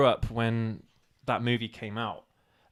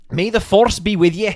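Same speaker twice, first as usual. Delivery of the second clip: louder, higher hammed-up regional accent; 6 semitones higher, 12 dB louder than usual. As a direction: put on a bold regional British accent, loud and high. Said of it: "Do that again".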